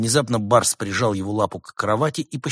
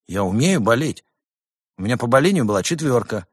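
offended protest; 3 semitones higher, 5 dB louder than usual. A narrator's reading. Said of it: "обиженно возразил".

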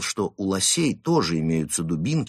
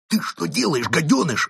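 higher-pitched angry hiss; hissed reproach; 8 semitones higher than usual. A narrator's reading. First wolf scolding his loudmouthed captive, hissing. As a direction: hiss it at him in alarm. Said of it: "зашипел на него первый волк".

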